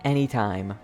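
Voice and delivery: ordinary talk, nothing marked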